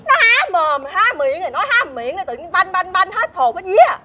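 high-pitched, flustered scolding; hammed-up fluster; exasperated correction. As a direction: correct their wording as if scared witless. Scolding the wording as frightening.

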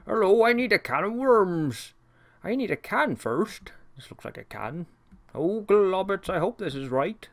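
lightly voiced cartoon servant, cheerful; a hint of the fussy servant Burke greeting his pets; imitating Burke. Burke greets his pets in the kitchen, lightly voiced cheerful servant. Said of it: "Burke actually did sound something a little like that".

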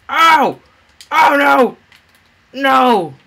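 loud high cry; pained panicked yell. Real, not acted. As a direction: yell as the hit lands. Hit by fireball.

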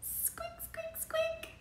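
high, soft squeaking; teacher squeaking like a mouse; mouse squeaks. Shown as a demonstration. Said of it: "Does a mouse say squeak".

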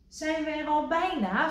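high, eager little frog voice; Kikker asking. A narrator's reading. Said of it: "vroeg Kikker".

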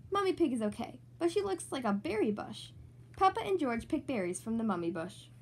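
plain reading voice

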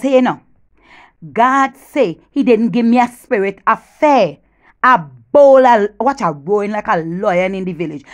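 ordinary talk, nothing marked

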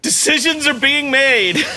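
ordinary talk

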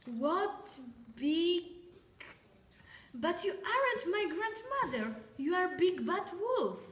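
high, startled realisation; alarmed girl. Acted. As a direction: voice jumps up in alarm. Girl unmasks the wolf.

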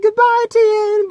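squeaky high, slow little-voice goodbye; high Chaozu voice. Retold or imitated. Chaozu bidding Tien farewell before exploding, high and slow. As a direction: play Chaozu's tiny, high goodbye, drawn out.

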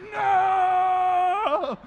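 long, high, drawn-out scream; frustrated yell. Retold or imitated. Son attacking the rigged machine, frustrated scream. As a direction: wail it like a kid robbed of the prize. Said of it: "And I get frustrated".

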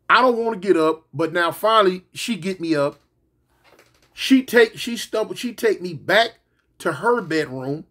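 ordinary talk, nothing marked

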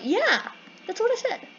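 high-pitched self-quote; retold protest. Player retelling the argument, high insistent self-quote. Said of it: "and I'm like".

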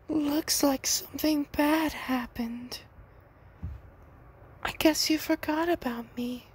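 sweet but unsettling, like a creepy doll; Circus Baby voice. Retold or imitated. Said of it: "Let's do this voice".

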